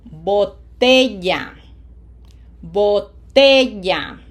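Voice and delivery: slow boTElla, loud held TE; stress on middle syllable. Shown as a demonstration. Teacher models Botella for learners, slow, stressed on TE. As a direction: stretch and punch TE in Botella. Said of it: "syllable number two".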